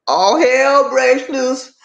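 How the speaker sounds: high, slow, drawn-out holler; dramatic outcry. Acted. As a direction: stretch each word in a high mock wail. Dramatizes the couple's fight erupting.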